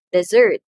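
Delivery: "dessert" as de-ZERT, loud; verb stress. Shown as a demonstration. Teacher asks about the verb, "dessert" stressed on second syllable. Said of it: "second syllable receives the emphasis".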